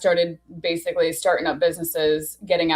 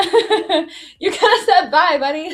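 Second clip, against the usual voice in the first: laughing; 10 semitones higher, 5 dB louder than usual. Real, not acted. Laughing while retelling the goodbye.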